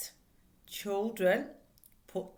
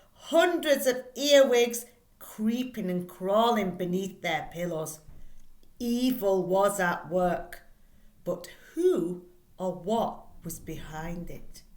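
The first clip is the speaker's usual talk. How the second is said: spooky story reading; 5 dB louder than usual. Acted. Teacher reads the spooky blurb to her class, dramatically. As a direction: read it like a spooky tale to kids.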